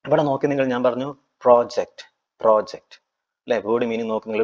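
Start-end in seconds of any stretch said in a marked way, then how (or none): 1.4-2.1 പ്രോജക്റ്റ് with the first syllable pro stressed. Shown as a demonstration. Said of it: "first പ്രോജക്ട് എന്ന് പറഞ്ഞാൽ എവിടെ". 2.4-3.0 പ്രോജക്റ്റ് with the first syllable pro stressed. Shown as a demonstration. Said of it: "first പ്രോജക്ട് എന്ന് പറഞ്ഞാൽ എവിടെ".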